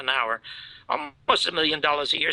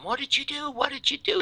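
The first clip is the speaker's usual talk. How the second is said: retold scream; 11 semitones higher than usual. Screaming at the producer over the re-edit, high retold scream. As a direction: re-enact screaming at someone, pitched way up. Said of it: "I scream at him".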